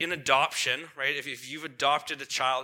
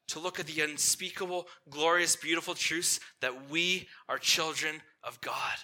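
ordinary talk

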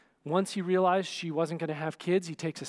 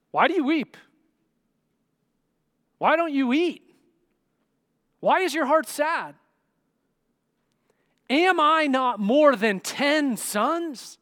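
high, loud, insistent questioning; exasperated husband; 8 semitones higher, 8 dB louder than usual. Acted. Husband asks his weeping wife why she won't eat, exasperated. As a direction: press each question harder, slowing on the last.

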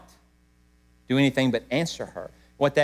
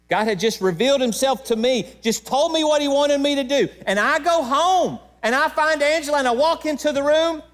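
higher, charged with real emotion; emotional; 10 semitones higher than usual. Real, not acted. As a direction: voice lifted by feeling he can barely hold. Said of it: "I'm about as emotional as I am right now".

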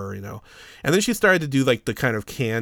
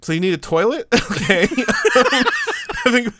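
giggling through it; 9 semitones higher, 5 dB louder than usual. Retold or imitated. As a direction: try to stay serious but keep cracking up. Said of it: "Yeah, I was giggling".

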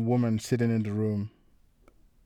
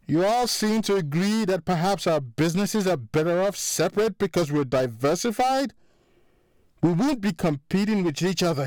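rising anger; 6 semitones higher than usual. A narrator's reading. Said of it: "his voice getting louder".

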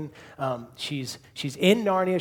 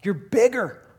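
put-on high, louder child's exclamation; Lucy; 7 semitones higher, 7 dB louder than usual. Youngest sister seeing the lion, high and amazed. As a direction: exclaim it in a raised, wondering little-girl voice. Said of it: "Lucy says".

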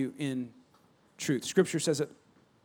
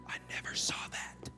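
whisper; 14 dB quieter than usual. Retold or imitated. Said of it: "whisper heard".

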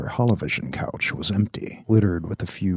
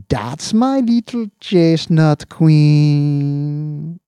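slow, high, loud proud cooing; proud doting; 9 semitones higher, 9 dB louder, much slower than usual. Acted. Owner praising his cat, proud cooing. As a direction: beam with pride. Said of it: "gleamed with pride".

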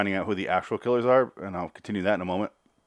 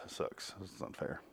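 whispered aside; 3 semitones lower, 20 dB quieter than usual. Real, not acted. Muttered joke aside.

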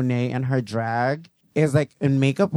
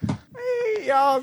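shouting hey. Real, not acted. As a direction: call out across the room. Shouted greeting, very high.

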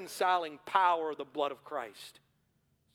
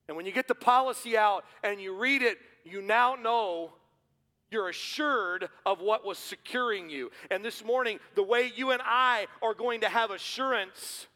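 louder, higher preaching, building to a shout; preaching at full force; 6 semitones higher, 6 dB louder than usual. Acted. Pastor urging the congregation, full-force preaching. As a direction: drive it home to the church.

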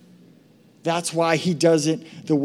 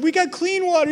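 put-on whiny child; 12 semitones higher than usual.

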